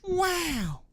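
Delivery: high, slow mocking voice; high, drawn-out mock wonder; mock writers. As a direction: play the lazy writers in a high silly voice. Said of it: "it was like".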